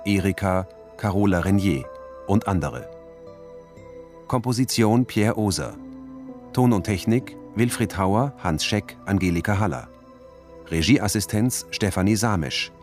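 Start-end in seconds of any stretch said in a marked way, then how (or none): none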